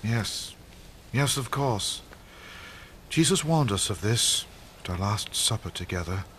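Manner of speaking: quiet realization. Acted. Peter recalls the warning.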